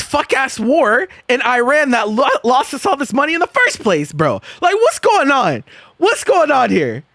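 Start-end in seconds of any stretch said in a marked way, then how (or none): 4.6-7.0 high-pitched incredulous rant; exasperated disbelief. Real, not acted. Streamer to chat about government Venmo donations, exasperated disbelief. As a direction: demand answers, voice pitched up in disbelief.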